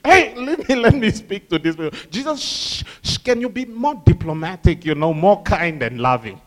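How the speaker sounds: mock-pleading with him to tone it down; lightly voiced nervous crowd shushing; hushing people. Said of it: "Sometimes people are like".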